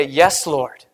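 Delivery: humble yes. Retold or imitated. Surrendering answer to God.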